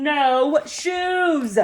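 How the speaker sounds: put-on drawn-out toddler protest, lightly shouted; mild mock shout. A narrator's reading. Child refusing to wear shoes, mock shouting. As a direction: call it out like a stubborn child.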